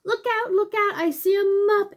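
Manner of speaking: high, loud "look out!" alarm; excited warning. Acted. Warning the story bears about the mop, high and loud. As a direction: call out the danger like a kid in the story.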